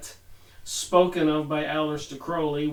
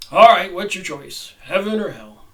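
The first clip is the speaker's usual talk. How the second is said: Saint Peter; 9 dB louder than usual. Acted. Saint Peter asks choice.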